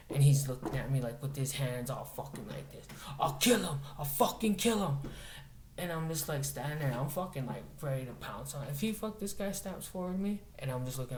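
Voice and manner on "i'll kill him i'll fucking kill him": raised, higher-pitched imitation of a furious threat; mock enraged yelling. Hippie threatening the man before him, mock enraged yell. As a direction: punch out the threat as the screaming man. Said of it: "he starts yelling and screaming".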